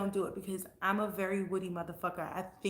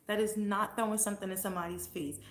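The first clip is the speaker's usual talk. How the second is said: normal talking voice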